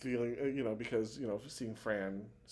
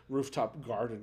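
usual speaking voice

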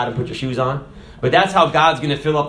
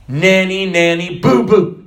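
put-on high, louder childish nanny nanny boo boo; taunt; 4 semitones higher, 7 dB louder, slower than usual. Taunting the enemy with praise, a childish sing-song. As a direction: tease like a child on a playground. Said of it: "You ever said that to someone?".

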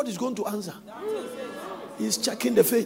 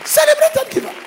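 high, loud exclamation; excited cry; 6 dB louder than usual. Real, not acted. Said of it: "preacher exclaims".

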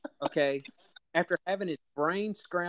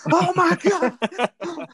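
much higher, louder, slow drawn-out "Oh my God!"; mock scream; 9 dB louder, much slower than usual. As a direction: replay the brother-in-law's scream in a hammed-up way. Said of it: "him screaming".

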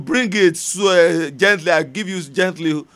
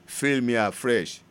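quiet prayer; 6 semitones lower, 3 dB quieter than usual. Acted. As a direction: pray it softly and earnestly.

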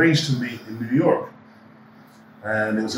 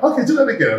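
excited; 13 semitones higher, 5 dB louder than usual. Retold or imitated. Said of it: "he was like".

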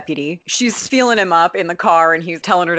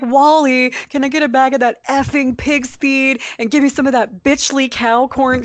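mock Annie rant; 8 semitones higher than usual. Host quoting Annie's fake swearing to her husband, high mock rant. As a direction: ham up her prim fake cussing. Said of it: "She was like".

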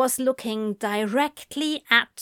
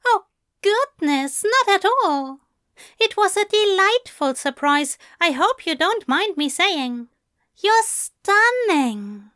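higher, gentle English gentleman; Aziraphale voice; 9 semitones higher, 4 dB louder than usual. A narrator's reading. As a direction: lift the voice for the fussy angel.

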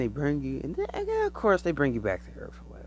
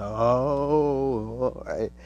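loud startled oh; a burst of wonder at the door; mock amazed oh; 5 dB louder, much slower than usual. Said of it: "and you're like".

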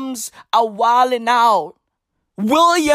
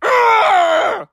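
loud high frustrated urgh; high strangled yell of rage; angry groan shout; 9 semitones higher, 3 dB louder than usual. Acted. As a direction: roar out the frustration. Furious about the arrest news.

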